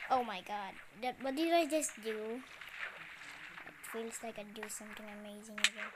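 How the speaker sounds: usual speaking voice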